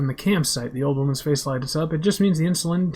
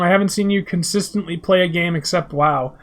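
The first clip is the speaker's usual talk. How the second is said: ordinary talk, nothing marked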